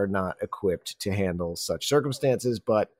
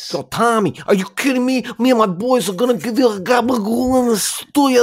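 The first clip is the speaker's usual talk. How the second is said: high-pitched mock Italian-American rant; hammed-up Italian-American accent; 11 semitones higher, 3 dB louder than usual. Podcaster spoofing a friend's accent, over-the-top Italian-American. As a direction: parody an indignant Northeast Italian, pitched up.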